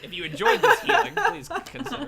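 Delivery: high laughing sales pitch; laughing mock promo. Game master jokes about healer's self-promotion, laughing. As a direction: pitch it like an ad while cracking up.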